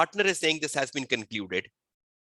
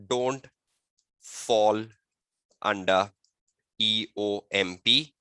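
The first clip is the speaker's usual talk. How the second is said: word by word, long pauses between phrases; slow dictation; 3 semitones lower, slower than usual. Shown as a demonstration. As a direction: dictate an answer slowly for students to write down. Dictates the model written answer.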